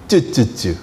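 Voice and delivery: low, soft tongue clicks of scorn; tsk tsk tsk. Retold or imitated. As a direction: click your tongue in disapproval. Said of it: "머리를 흔들면서 쯔쯔쯔 하면서". (낮고 조용하게 혀 차는 소리)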